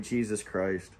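low, very soft oath; hushed dread. Retold or imitated. Driver to himself as the trooper stands up, hushed dread. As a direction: say it under your breath, low and dismayed. Sees trooper with hidden eyes.

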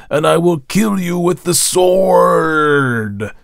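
loud, high, drawn-out bellow; bellowing preacher. A narrator's reading. Minister roars scripture at the congregation, bellowing. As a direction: thunder it slowly, stretching the big words. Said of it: "but when he bellowed".

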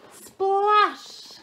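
high, loud story splash; big splash effect. A narrator's reading. Nest falls into the lake, loud exclaimed splash. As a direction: burst out the splash word.

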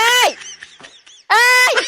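calling out. Acted. Calling out to someone.

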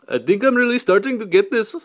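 high, loud put-on voice; mock-crazed outburst. Vlogger clowning about getting the hang of it, mock-crazed outburst.

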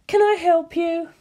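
high, sugary receptionist; a high front-desk voice with an edge; suspicious receptionist. Acted. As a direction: receptionist catching an intruder, high and sweet. Receptionist catches Waluigi.

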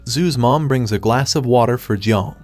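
ordinary delivery

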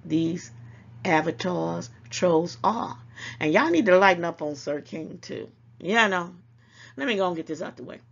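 ordinary talk, nothing marked

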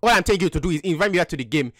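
put-on African accent. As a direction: speak in a mock African accent. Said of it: "so you don't have an African accent".

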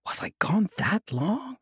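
breathy unvoiced wonder; whispering. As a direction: whisper it in puzzled surprise. Old monk puzzled, whispered.

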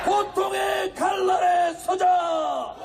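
slow, roaring rally chant; roaring rallying speech. Acted. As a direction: rouse the crowd, word by word.

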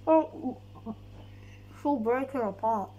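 ordinary talk

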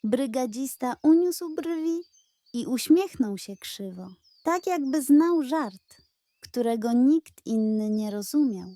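ordinary delivery, nothing marked

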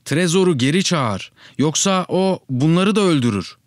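high-pitched, pleading alarm; acted urgent shouting. A narrator's reading. Child begs father to call Trezor back, high urgent cry. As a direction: cry out high to stop the dog in time. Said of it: "diye bağırdım".

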